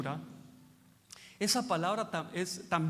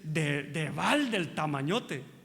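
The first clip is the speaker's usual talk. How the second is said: slow mock scold; 5 semitones lower, much slower than usual.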